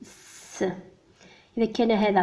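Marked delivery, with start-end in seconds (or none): 0.0-0.6 "س" as a bare voiceless hiss, no vowel; just hiss the "س" softly; a long unvoiced sss for "س"; hissed s sound. Shown as a demonstration. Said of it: "فإنه دائمًا ينطق".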